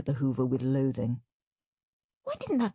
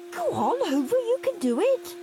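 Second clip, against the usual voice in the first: coaxing. Acted. Coaxing the hoover.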